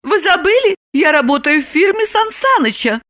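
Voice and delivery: high-voiced proud woman; proud reminder. A narrator's reading. Woman reminds Roman of her job, high proud voice. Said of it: "с гордостью напомнила она".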